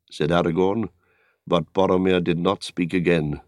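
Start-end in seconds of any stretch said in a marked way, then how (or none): none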